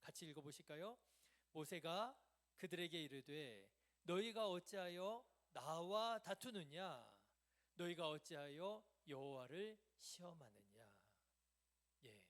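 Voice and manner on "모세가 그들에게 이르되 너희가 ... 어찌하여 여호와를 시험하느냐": loud, slow, measured reading; scripture reading. Real, not acted. Preacher leading the congregation in the verse, loud and deliberate. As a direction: read it out strongly for all to follow.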